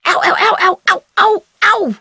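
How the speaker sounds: rapid high ows of pain. Real, not acted. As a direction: hop through the prickles crying ow. Stepping into burrs.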